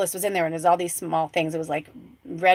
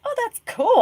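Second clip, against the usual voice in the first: much higher, mock-bright little cool; mock delight; 3 dB louder than usual. Telling viewers how she reacted to eleven watchers, in a much higher mock-pleased voice. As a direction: squeak it out like a pleased surprise.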